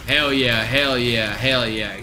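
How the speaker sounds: ordinary talk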